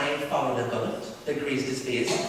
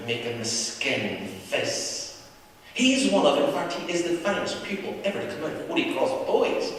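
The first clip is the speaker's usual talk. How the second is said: usual speaking voice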